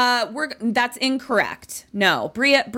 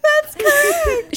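high excited; 5 dB louder, slower than usual. Real, not acted. Host overwhelmed by answer.

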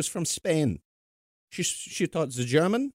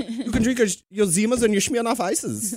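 mock German accent; 10 semitones higher, 5 dB louder than usual. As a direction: ham up a beer-fest German accent. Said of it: "I think that's your best accent".

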